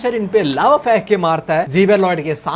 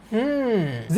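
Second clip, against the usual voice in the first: a long quiet thinking hum; thoughtful hum; 8 dB quieter, much slower than usual. Retold or imitated. Xavier pondering the monster's words. (सोचते हुए धीमी हम्म)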